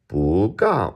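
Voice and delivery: "bu" rising, "ga" high and falling; second then fourth tone. Shown as a demonstration. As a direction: lift "bu", then drop "ga" hard. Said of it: "changing the first one".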